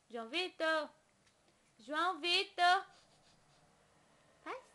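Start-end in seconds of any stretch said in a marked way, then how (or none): 1.8-2.9 insistent loud name call; louder call. Real, not acted. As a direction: call his name again, clearly louder. Calling baby's name louder.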